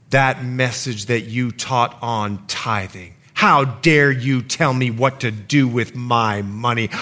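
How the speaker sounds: low, slow seething indignation; retold fury. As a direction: imitate someone furious but holding it in. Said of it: "She was so mad".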